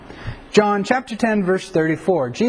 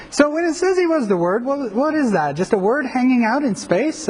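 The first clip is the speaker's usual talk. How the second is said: higher, quick, baffled comic voice; a puzzled comedian, pitched up and rushed; Dudley Moore puzzled; 6 semitones higher than usual. Retold or imitated. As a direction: play Dudley Moore scratching his head over the verse. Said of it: "Dudley Moore and his comedic, uh, abilities is like".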